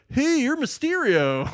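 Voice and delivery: high, squeaky mock bystander blurting it out. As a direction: pitch it way up like a dumbfounded onlooker. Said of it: "I was like, there's no way anybody wouldn't be like".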